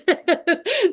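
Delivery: sudden high burst of giggling; high giggle. Real, not acted. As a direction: break into a giggle. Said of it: "I can't even say it".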